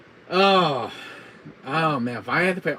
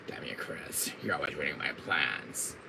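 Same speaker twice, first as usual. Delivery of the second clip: low, quiet villain grumble; seething villain under the breath; hushed mock resentment; Wesker; 8 semitones lower, 8 dB quieter than usual. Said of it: "my Wesker voice".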